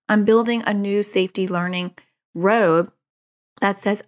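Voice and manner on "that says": ordinary talk, nothing marked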